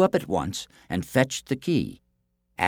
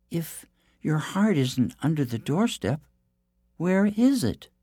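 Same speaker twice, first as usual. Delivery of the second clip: princess voice; 6 semitones higher than usual. Acted. Princess asks giant, high voice.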